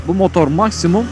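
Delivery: ordinary delivery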